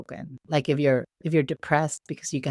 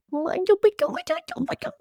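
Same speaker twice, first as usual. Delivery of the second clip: put-on much higher, squealing over the baby; gushing; faster than usual. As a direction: squeal with delight at how cute she is. Gushing over her own baby.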